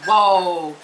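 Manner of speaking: normal talking voice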